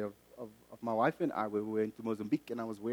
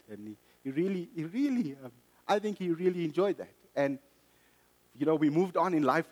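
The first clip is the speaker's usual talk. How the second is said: usual speaking voice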